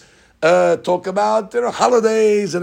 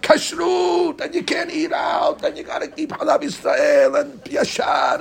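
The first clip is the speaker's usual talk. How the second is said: riot act; 7 semitones higher, slower than usual. Acted. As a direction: thunder the rules at the crowd. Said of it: "he read him the riot act".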